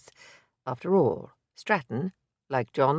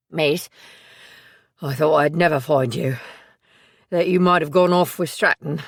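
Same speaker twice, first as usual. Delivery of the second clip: a louder, rougher working-class voice, out of breath; he has run all the way to find her; Billy the loyal assistant; 6 dB louder than usual. Acted. Billy to Maisie after running, panting relief.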